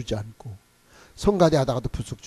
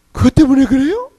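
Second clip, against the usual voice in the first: tearful; 11 semitones higher, 8 dB louder than usual. Retold or imitated. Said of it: "막 우는 거야".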